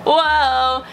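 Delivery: ordinary talk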